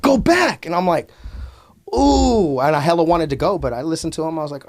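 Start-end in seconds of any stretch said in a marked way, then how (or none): 0.0-0.7 urgent order. Retold or imitated. Wood ordering friend away. 1.9-2.6 disappointed whine. Retold or imitated. Disappointed whine at being sent back.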